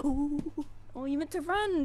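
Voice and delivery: high, loud delighted gasp at the cat; excited pet talk. Real, not acted. Owner delighting in her running cat, excited. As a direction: cheer the cat on as it bolts.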